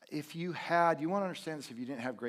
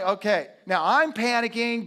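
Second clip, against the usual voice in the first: mock panic; 9 semitones higher, 13 dB louder than usual. Doctor imitated panicking.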